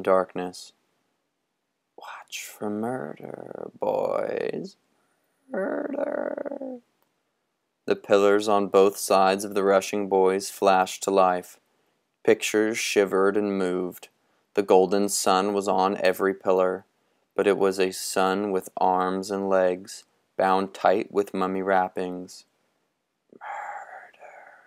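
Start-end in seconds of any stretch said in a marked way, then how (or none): 2.0-6.8 gusting windy whisper. Acted. Wind voice warns the boys. 23.3-24.6 gusting windy whisper. Acted. Wind voice echoes murder.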